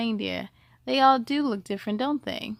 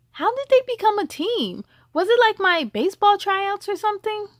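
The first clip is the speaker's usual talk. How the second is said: put-on curious child; 8 semitones higher, 5 dB louder than usual. A narrator's reading. Child voice asking eagerly.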